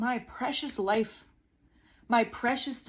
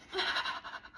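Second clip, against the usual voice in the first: exasperated sigh; 5 dB quieter than usual. Retold or imitated. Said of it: "And I would be like".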